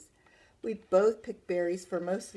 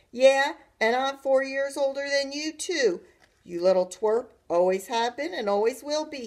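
loud, higher taunting call; calling after; 7 semitones higher, 8 dB louder than usual. A narrator's reading. Brother to his retreating sister, calling out. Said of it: "he called after me".

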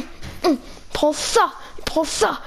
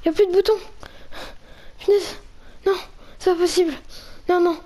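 higher, whimpering dismay; mock whimper; 4 semitones higher, faster than usual. Player locked out of the bunker, mock whimpering. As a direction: whine like you're about to cry.

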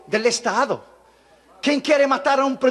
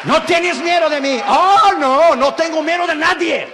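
higher and louder, fearless; bold loud reply; 6 semitones higher, 6 dB louder than usual. Retold or imitated. Preacher telling the satanist leader he fears nobody, loud and high. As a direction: fling the answer back without fear. Retold tense phone exchange.

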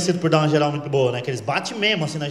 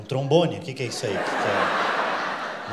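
much lower, dissolving into laughter; laughing mock answer; 10 semitones lower, 6 dB quieter, slower than usual. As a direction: giggle through the sarcasm. Resposta debochada que não disse. (rindo, debochado)

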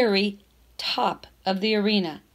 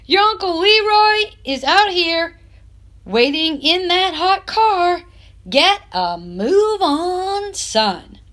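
impatient grandfather; 7 semitones higher than usual. A narrator's reading. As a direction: drag out get a move on like a fed-up granddad. Grandfather hurrying the boy along.

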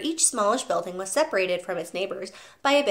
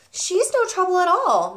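put-on lightly higher, polite young teacher; a gentle, earnest teacher voice; Miss Honey; 10 semitones higher, 5 dB louder than usual. A narrator's reading. Teacher apologizing to the father for calling, polite. Teacher bringing good news.

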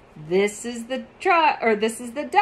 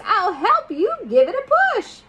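higher, quick frog voice; croaking frog; 10 semitones higher, 5 dB louder, faster than usual. Acted. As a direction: offer help as a chirpy frog. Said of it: "and croaks".

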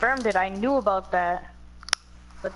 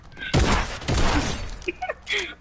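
high delighted laugh; 12 semitones higher than usual. Real, not acted. Big laugh at the game.

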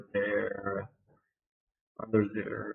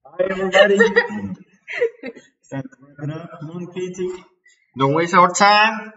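loud, higher hyped-up host greeting; mock host voice; 7 semitones higher, 7 dB louder than usual. Hamming up a show host urging Katie on, loud and high.

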